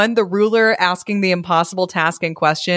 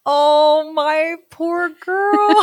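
cooing; 12 semitones higher, much slower than usual. Real, not acted. Coos over the lassie.

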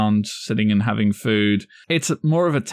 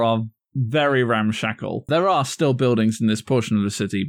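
ordinary talk

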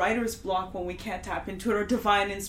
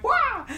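high mock wail; 3 dB louder than usual. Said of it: "but I went".

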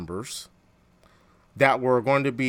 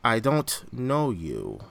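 slow, drawn-out bad Khan accent; hammed-up Khan accent with long vowels; 3 semitones lower, 5 dB quieter, much slower than usual. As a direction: do a horrible Khan accent, drawing out each word. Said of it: "That's a horrible accent".

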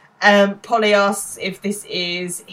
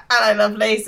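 louder, higher cheeky call; retold shout; 4 semitones higher, 7 dB louder than usual. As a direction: imitate a lad calling out to girls. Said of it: "honks the horn and shouts out".

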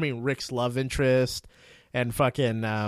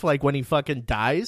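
ordinary talk, nothing marked

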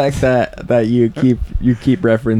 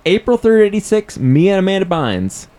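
excited; 7 semitones higher than usual. Real, not acted. Excited repeat.

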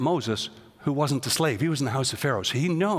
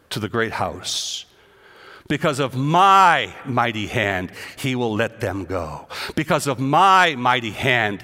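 scripture reading; 4 semitones lower, 3 dB louder than usual. Acted. Preacher reading God's words to the congregation, emphatic. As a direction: proclaim the Lord's words.

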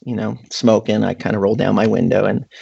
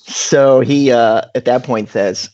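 normal talking voice

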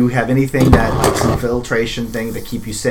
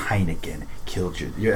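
grave; 5 semitones lower, 10 dB quieter than usual. Acted. Breaking news of son's death.

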